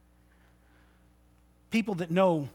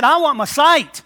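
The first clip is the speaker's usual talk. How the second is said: higher and louder, certain; bold cry; 6 semitones higher, 11 dB louder than usual. Acted. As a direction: declare it with full faith. Blind man's bold request.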